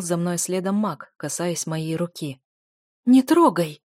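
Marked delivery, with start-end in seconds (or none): 3.1-3.8 higher, louder angry warning; sudden loud rebuff; angry hiss. A narrator's reading. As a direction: snap it out as a sharp warning. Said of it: "зашипела я".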